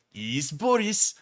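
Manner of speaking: slow, put-on Russian accent; mock Russian accent. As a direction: draw it out with a fake Russian accent. Quotes comment in Boris's Russian accent.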